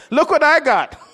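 high, loud, laughing brag; laughing boast. Retold or imitated. New millionaire brushing off an insult, laughing boast. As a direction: gloat through a laugh. Gloating over a million dollars.

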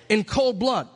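ordinary talk

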